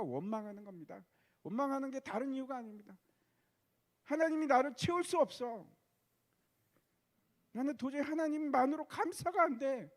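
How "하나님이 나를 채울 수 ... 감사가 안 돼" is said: higher, louder plaintive cry of discontent; raised despairing protest; anguished complaint. Acted. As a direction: cry out as a believer who feels unfilled. Voicing a discontented believer.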